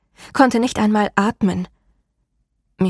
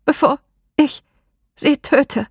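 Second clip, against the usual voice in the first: high, louder strangled plea; choked woman's voice; 7 semitones higher, 3 dB louder than usual. A narrator's reading. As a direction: force it out through a choked throat. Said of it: "die erstickte Stimme einer Frau".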